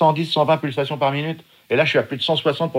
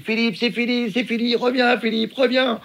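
much higher call to come back; shouting over wind; 8 semitones higher than usual. Retold or imitated. Valérie calling him back over wind, higher mock shout. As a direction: plead with him to stop, over the waves. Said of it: "Valérie me crie très, très fort".

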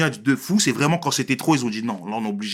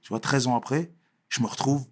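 ordinary talk

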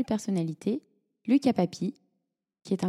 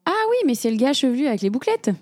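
higher, louder, quicker quoted exclamation; imitated recognition; 5 semitones higher, 5 dB louder, faster than usual. Host mimicking people who know the runner, excited recognition. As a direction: blurt it out like people suddenly placing someone.

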